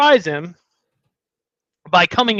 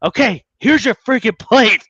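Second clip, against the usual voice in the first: raised, mock-obliging sarcasm; sarcastic; 4 semitones higher, 4 dB louder than usual. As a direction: imitate an annoyed dad handing it over sarcastically. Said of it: "sarcastically was like".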